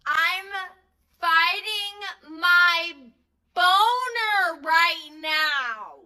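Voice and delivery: dragging each word out slowly, imitating him; slow mock line. Guy hitting on her sweet friend, slowly drawn out. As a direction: stretch it out like a creepy slow confession. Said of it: "he turns to her and he says".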